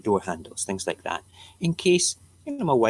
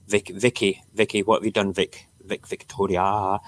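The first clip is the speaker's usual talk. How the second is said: ordinary talk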